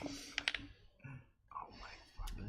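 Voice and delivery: breathless slow whisper of disbelief. Real, not acted. Player watching a tense roll, whispered under breath.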